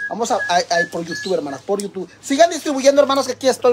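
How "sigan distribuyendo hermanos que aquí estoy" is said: loud, higher rallying call. Real, not acted. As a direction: call out to the viewers to keep sharing. Calling out to viewers.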